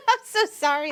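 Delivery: high laughing apology. Real, not acted. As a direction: giggle through it.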